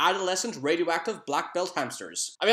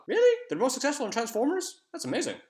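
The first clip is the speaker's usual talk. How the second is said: surprised; 8 semitones higher, faster than usual. Real, not acted. Mock surprise at trivia fact.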